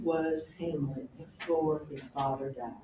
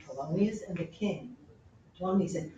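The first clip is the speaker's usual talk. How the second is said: normal talking voice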